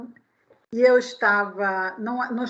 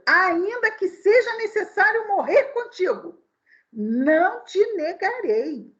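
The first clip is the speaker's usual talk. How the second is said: a hint of Peter swearing, slow at the end; lightly voiced emphatic vow; Peter's vow; 7 semitones higher than usual. Preacher quoting Peter's vow to Jesus, mildly acted. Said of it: "Disse-lhe Pedro".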